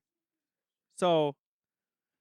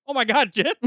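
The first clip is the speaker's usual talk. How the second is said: surprised; 5 semitones higher, 8 dB louder than usual. Retold or imitated. Retold surprise on the phone.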